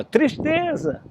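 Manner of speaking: grito agudo e lento; grito arrastado. Acted. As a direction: estique o grito, bem agudo. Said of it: "Aí ele gritava". (menino grita a palavra devagar, agudo e arrastado)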